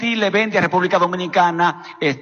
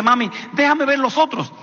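mother checking; 8 semitones higher than usual. Retold or imitated. Mother checks other jeans.

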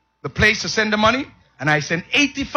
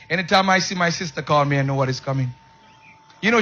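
ordinary talk

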